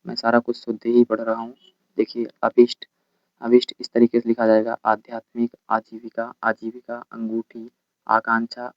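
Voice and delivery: ordinary talk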